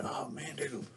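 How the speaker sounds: hushed whisper to himself; whispered thought. Retold or imitated. Kid alone in back seat, whispering. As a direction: whisper it so nobody hears. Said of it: "and I'm like".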